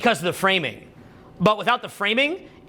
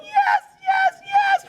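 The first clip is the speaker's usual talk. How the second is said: very high squeaky entranced voice; squeaky awed stranger; 3 dB louder, much slower than usual. Retold or imitated. Stranger answering the mystic, squeaky high falsetto. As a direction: play a starstruck stranger in falsetto. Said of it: "They're like".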